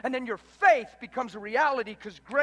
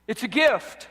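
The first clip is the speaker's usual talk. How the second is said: normal talking voice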